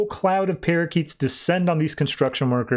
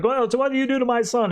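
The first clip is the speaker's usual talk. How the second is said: mock screaming mom; 9 semitones higher, faster than usual. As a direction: imitate a mom's outcry, lightly. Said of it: "the mom's screaming through the phone".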